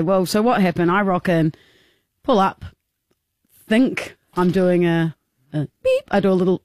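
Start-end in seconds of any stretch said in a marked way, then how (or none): 5.5-6.0 high squeaky beep; imitated car beep. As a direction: chirp it like a tiny car horn. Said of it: "this is how I imagine my toot to go".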